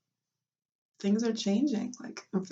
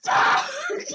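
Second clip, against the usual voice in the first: high, loud hammed-up cry of pain; retelling the hard contraction, mock shout; 8 dB louder than usual. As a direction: yell it like a strong contraction hit. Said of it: "later you're like".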